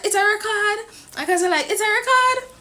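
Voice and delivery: high, excited insistence; insistent. Retold or imitated. Fan telling the cameraman to record, high and eager. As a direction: press the point eagerly.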